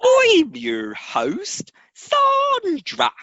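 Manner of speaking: very high, drawn-out mock-English introduction; hammed-up English host sign-on; exaggerated English accent. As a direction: stretch each word in a silly English accent. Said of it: "with your best English accent".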